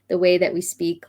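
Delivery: ordinary talk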